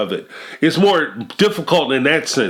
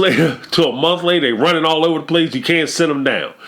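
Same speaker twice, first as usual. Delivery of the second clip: normal talking voice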